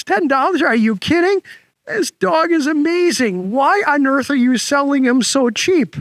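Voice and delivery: high, incredulous buyer's voice; amazed buyer. Acted. Buyer amazed at low price.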